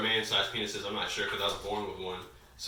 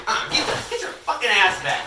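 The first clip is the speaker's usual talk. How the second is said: laughing, higher and louder; laughing shout; 11 semitones higher, 7 dB louder than usual. Real, not acted. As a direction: yell through laughter. Laughing, pulling him back.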